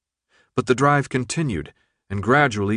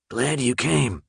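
put-on rough, rasping young man; raspy voice; 2 semitones higher, slower than usual. A narrator's reading. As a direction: rasp it out, scarred and menacing. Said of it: "in his raspy voice".

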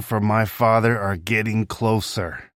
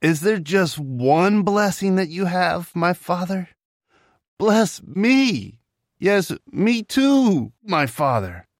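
higher, mildly tearful begging; tearful plea; 8 semitones higher, slower than usual. Acted. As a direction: stretch "me too" as if near tears. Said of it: "Esau cried loudly and burst into tears".

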